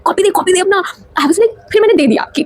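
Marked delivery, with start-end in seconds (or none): 0.0-0.8 sharp high yell that drops off; mock yell of teacher. The teacher yelling at her in class, mock yell. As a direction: shout the order as the teacher did. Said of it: "वो ऐसे मेरे पे चिल्लाई कि".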